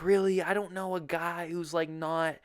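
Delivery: put-on high, disbelieving voice; mock surprised woman. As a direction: act out her incredulous reply. Imitates friend's surprised reply.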